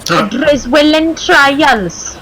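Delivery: put-on broad, slow Welsh accent; drawn-out Welsh accent. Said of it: "you just did a Welsh accent".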